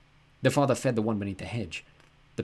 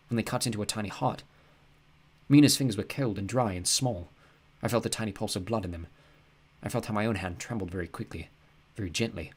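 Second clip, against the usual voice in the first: plain reading voice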